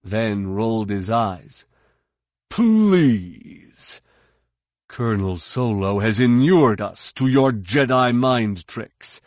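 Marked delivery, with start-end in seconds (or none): 2.5-9.2 scoffing. A narrator's reading. Serpa scoffs.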